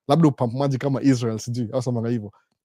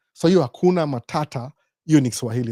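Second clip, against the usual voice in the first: ordinary talk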